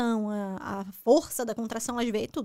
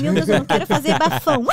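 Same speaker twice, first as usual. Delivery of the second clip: louder, urgent pleading to God; retold inner plea; 8 dB louder than usual. Mother in labour recalling her prayer, loud plea. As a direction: beg not to make a scene. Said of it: "Aí eu disse".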